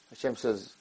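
ordinary delivery

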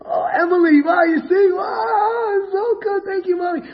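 put-on very high, gushing child voice; excited little girl. Daughter thanking Mommy for her cup, excited. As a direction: squeal with delight, drawing out the wow. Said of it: "Tomorrow she gets excited".

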